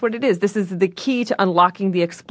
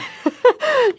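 high-pitched burst of laughter; helpless high giggle; real laugh. Real, not acted. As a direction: crack up at the host's wordplay. Guest cracks up at joke.

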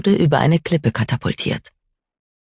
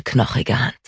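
ordinary delivery

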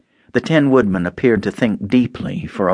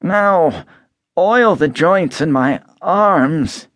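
higher, slow rusted voice; stiff Tin Man, slow; 7 semitones higher, slower than usual. A narrator's reading. Tin Man begging Dorothy for oil, slow and higher. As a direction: ask slowly as if every joint is stuck.

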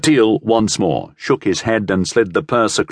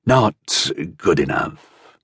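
slow, lower, mildly weary refusal; sighed; 4 semitones lower, slower than usual. A narrator's reading. Sellsword turning down the purse, sighing. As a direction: let it out slowly with a touch of a sigh. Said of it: "he sighed".

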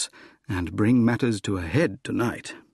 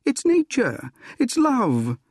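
high-pitched ardent plea; impassioned protest; pleading; 13 semitones higher, 4 dB louder, slower than usual. Acted. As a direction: plead for love with pitch raised. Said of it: "I pleaded".

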